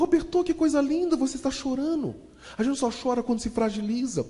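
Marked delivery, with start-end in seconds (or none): 0.0-2.1 tender madame. Retold or imitated. Madame moved by his tears.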